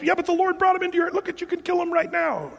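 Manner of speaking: high, rushed, insistent urging; acted urging. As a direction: press him urgently in a high voice. Abishai urging David to kill Saul.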